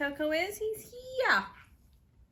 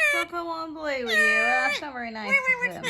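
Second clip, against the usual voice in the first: pet talk; 8 dB louder than usual. Real, not acted. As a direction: coo sympathy at the pet. Cooing to rejected pet.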